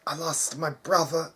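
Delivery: high, pained voice, gasping between words; a wounded boy forcing words out through pain; painful breaths. Acted. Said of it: "said through painful breaths".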